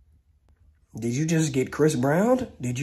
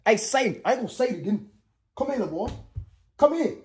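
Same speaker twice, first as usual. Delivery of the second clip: calling out; 7 semitones higher than usual. Real, not acted. Calling the child over.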